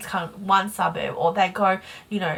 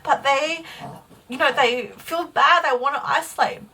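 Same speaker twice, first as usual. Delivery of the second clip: fighting back tears as you speak; real emotion welling up, pitched higher; getting emotional; 6 semitones higher than usual. Real, not acted. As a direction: voice rising high, close to tears. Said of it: "I don't know why I'm getting emotional".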